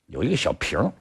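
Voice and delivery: ordinary delivery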